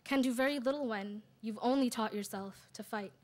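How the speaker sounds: measured, deliberate poem reading; poetry recital. Acted. Sister's poem for brother in Cairo, measured recital. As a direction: recite it in weighted phrases with pauses.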